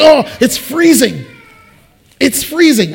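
loud exclamation of being frozen; complaining about the cold. Retold or imitated. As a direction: cry out that it's freezing. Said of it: "And say".

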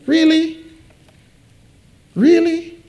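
high-pitched, incredulous questioning; mock disbelief. Preacher scoffing at his younger self's complaint, mock disbelief. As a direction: squeak it out as an exaggerated challenge. Mocks the complaint he just acted.

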